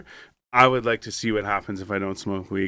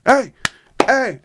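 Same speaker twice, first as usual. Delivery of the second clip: high, loud "hey, hey" to wake someone; loud call; 13 semitones higher, 9 dB louder than usual. Retold or imitated. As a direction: rouse a sleeper from the next room. Brother calling to wake him.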